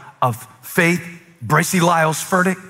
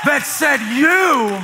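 climactic preaching; 11 semitones higher than usual. Acted. Pastor rallying the church, in climactic shouted preaching. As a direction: build the altar call to a peak.

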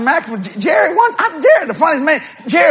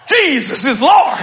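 blurted proclamation; 5 semitones higher, slower than usual. Retold or imitated. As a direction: shout it out to cover. Answers greeting in a blurt.

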